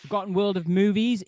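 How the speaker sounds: ordinary talk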